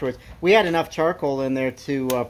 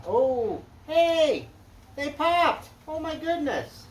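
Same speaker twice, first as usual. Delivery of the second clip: high, delighted surprise; thrilled the timers popped; excited surprise; 10 semitones higher, 4 dB quieter than usual. Real, not acted. Turkey thermometers popped, excited surprise.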